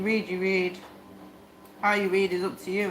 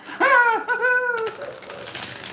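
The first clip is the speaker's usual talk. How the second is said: big squealing laugh; loud high laughing fit; 14 semitones higher, 4 dB louder than usual. Real, not acted. Laughing hard at the image of the battered boxer.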